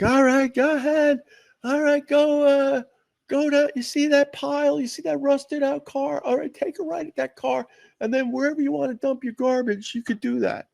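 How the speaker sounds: high, put-on gatekeeper voice; dump attendant impression. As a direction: call out directions as a dump worker. Acting out dump attendants directing cars.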